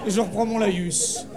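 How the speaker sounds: ordinary delivery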